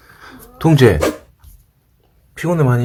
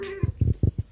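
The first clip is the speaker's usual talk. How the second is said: soft high giggle; 8 dB quieter than usual. Real, not acted.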